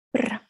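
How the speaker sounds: shiver sound. Acted. Shivering in snow.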